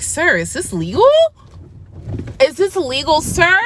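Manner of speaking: building from doubtful to shocked; incredulous disbelief. Retold or imitated. Shocked at legal THC gummies.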